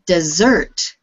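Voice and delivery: "dessert" with the second syllable stressed; slow, careful "dessert", said dih-ZERT. Shown as a demonstration. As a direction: hold back the start of "dessert", land on its end. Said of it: "with the stress on the second syllable".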